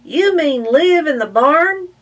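high, loud disbelieving question; incredulous. Acted. Willie asking if they'll live in the barn, high loud. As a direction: ask it in disbelief.

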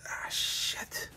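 breath-only, sinking swear; whispered curse. Acted. Michael catching himself in a dark admission, whispered curse. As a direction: let it out as a horrified whisper. Horrified at his own admission.

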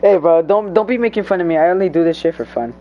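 loud, defensive complaint; loud protest. Real, not acted. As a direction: push back loudly at a teasing friend. Friend mocking him.

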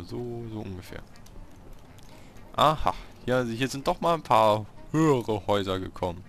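usual speaking voice

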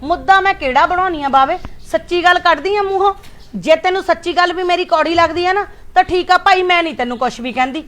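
angry mother. Acted. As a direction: lash out at the son in wounded anger. Mother angry quarrel with son. (ਗੁੱਸੇ ਵਿੱਚ ਉੱਚੀ ਆਵਾਜ਼)

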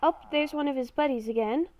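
normal talking voice

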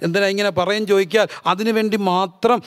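ordinary talk

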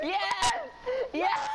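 very high, loud gleeful cheer; triumphant yes. Real, not acted. Friend's car broke down.

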